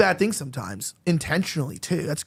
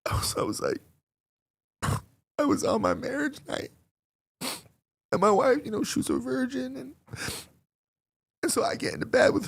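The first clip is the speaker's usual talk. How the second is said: mock crying confession; 6 semitones higher, 3 dB quieter than usual. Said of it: "And he's crying".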